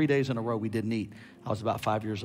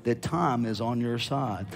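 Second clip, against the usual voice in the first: slow, building louder prophecy; prophetic declaration; 2 semitones higher, 5 dB louder, slower than usual. Acted. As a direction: declare each time stronger. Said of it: "the Lord says".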